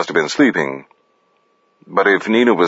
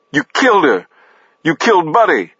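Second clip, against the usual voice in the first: anguished accusation; 10 semitones higher, faster than usual. A narrator's reading. Accusation in gun standoff.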